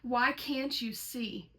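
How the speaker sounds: normal talking voice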